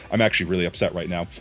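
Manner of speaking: low, soft, quick and unemotional; understated upset. Shown as a demonstration. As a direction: report being upset with no emotion. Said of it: "that's literally how I talk when I'm angry".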